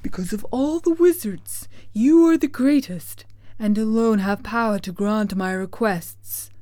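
earnest, theatrical lion's plea; Cowardly Lion. Acted. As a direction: flatter the wizard in a lion's voice. Lion answers Oz.

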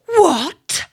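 loud, high exclamation of disbelief; outraged shock. A narrator's reading. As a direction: burst out in indignant astonishment. Elder sister hears the secret.

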